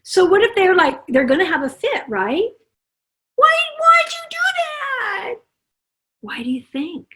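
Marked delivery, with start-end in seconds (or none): 3.3-5.3 put-on high, slow whiny cry of a child; whining child. Upset child protesting the toy taken, high whiny wail.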